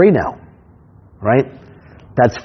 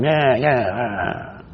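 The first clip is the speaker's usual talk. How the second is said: lazy Tony Soprano grunt, drawn out; a hint of New York mobster drawl; 6 dB quieter, much slower than usual. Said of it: "Tony Soprano thing going on".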